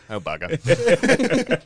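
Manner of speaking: high, drawn-out mock British groan; caught movie pirate. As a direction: stretch it slowly in comic dismay. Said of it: "Every time he gets caught".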